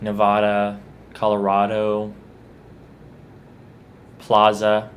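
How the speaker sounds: "Nevada, Colorado, plaza" with ah for the A; ah sound. Shown as a demonstration. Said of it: "make the ah sound with the A".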